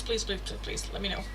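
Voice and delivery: ordinary talk, nothing marked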